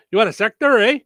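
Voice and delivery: mock Minnesota cashier; Minnesota accent. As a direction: ask it in a thick Minnesota accent. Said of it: "in Minnesota".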